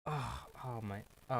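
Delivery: usual speaking voice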